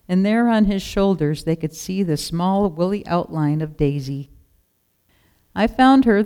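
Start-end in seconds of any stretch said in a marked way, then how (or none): 5.5-6.2 higher, louder joyful call; father calling the news to his sons. A narrator's reading. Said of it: "the shepherd called to his sons".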